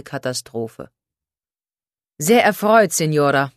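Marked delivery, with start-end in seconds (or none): 2.2-3.5 französischer Akzent, kräftig einsetzend; begrüße sie mit französischem Akzent. A narrator's reading. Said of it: "sagte er mit französischem Akzent". (mit französischem Akzent)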